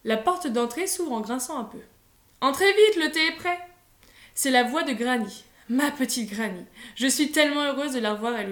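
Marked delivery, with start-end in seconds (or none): 2.4-3.6 higher, louder call to come in. A narrator's reading. Grandmother calling the family inside for tea, loud call. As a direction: call them in from the doorway. Granny calls from the door.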